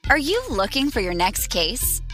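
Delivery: normal talking voice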